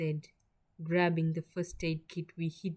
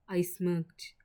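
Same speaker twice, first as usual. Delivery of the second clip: ordinary delivery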